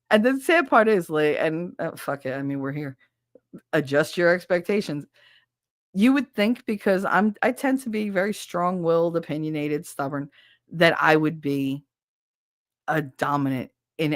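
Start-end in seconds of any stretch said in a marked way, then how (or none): none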